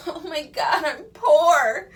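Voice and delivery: laughing, half-crying high wail; high, loud, laughing mock lament; mock despair. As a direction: ham up being broke through laughter. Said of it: "It's like".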